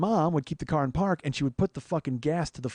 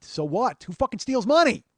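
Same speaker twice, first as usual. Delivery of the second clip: loud, higher exasperated rant; angry outburst; 8 semitones higher, 6 dB louder than usual. Real, not acted. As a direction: spit out the outrage at full volume. Angry rant about stolen money.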